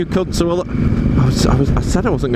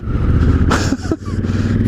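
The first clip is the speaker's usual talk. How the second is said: high incredulous laugh. Real, not acted. Laughing at the crowds.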